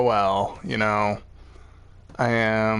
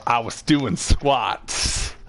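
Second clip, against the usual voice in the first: drawn out, very slow and awkward; slow awkward excuse; much slower than usual. Retold or imitated. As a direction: stretch it out like a bad lie.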